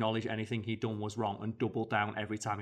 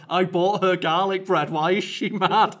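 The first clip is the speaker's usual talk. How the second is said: high, loud hammed-up bafflement; mock outraged protest; 7 semitones higher, 10 dB louder than usual. Boyfriend protesting about garlic bread to commenters, mock outrage. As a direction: shout it in disbelief, breaking into laughter.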